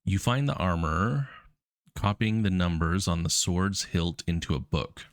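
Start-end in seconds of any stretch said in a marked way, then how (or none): none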